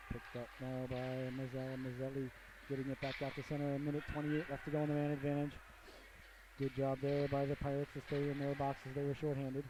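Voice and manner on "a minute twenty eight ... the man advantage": normal talking voice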